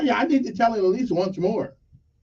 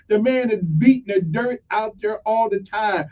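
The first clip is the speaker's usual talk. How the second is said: ordinary talk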